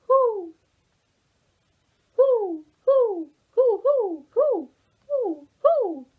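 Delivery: high, loud hooting who; owl hoots. Acted. Owl family asking their only question, hooting high and loud. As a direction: hoot each who like an owl. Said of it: "The only question they ever asked was".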